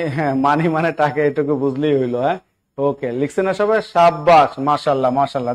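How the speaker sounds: normal talking voice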